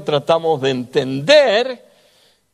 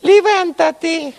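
much higher, squeaky mock command; silly high voice; 13 semitones higher, much slower than usual. Shown as a demonstration. Pastor contrasts a high squeaky voice that cannot be God's. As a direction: make God sound ridiculous and high. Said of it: "Y pongo otra voz".

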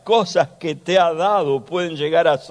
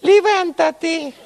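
"Levántate" much higher and a bit louder; high squeaky voice; 13 semitones higher, 3 dB louder, slower than usual. Shown as a demonstration. Pastor shows a voice that can't be God's, high. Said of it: "Y pongo otra voz".

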